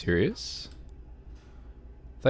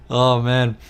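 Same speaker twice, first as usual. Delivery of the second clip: ordinary talk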